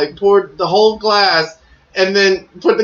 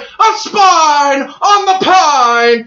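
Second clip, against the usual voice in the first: J R call; 9 semitones higher, 4 dB louder, much slower than usual. Retold or imitated. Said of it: "And he's like".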